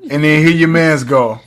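ordinary delivery

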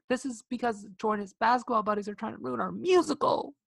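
high, put-on diva complaint; Sharpay impression. Host quotes Sharpay blaming Troy's buddies, high mock complaint. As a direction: whine it up high, stretching the last word. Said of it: "'Cause Sharpay's like".

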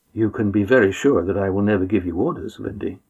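ordinary delivery

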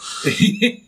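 sudden high laugh; high burst of laughter. Real, not acted. Telling friends the story, bursting into laughter.

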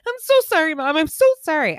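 put-on high, loud pleading child voice; whiny kid apology. Mom voicing her son pleading sorry, high and loud. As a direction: beg forgiveness like a scared little boy.

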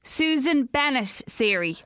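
slow, clear words for the phone; repeating to voice assistant. Real, not acted. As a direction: spell it out slowly for Siri.

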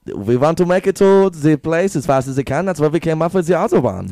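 high, pressed German voice; mock German accent. Joining a friend's German riff, high mock German accent. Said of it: "in the German accent".

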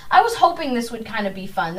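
ordinary delivery

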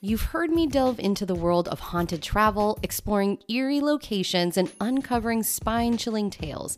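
upbeat promo read; tourism ad. Acted. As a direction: sell the trip like a radio spot. Host reads travel agency ad.

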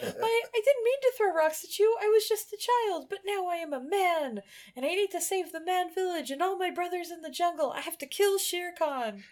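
put-on very high, earnest boy voice; high-pitched cartoon pleading; pleading Mowgli. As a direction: apologise like an anxious child.

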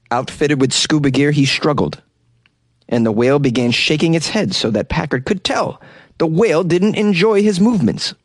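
ordinary talk, nothing marked

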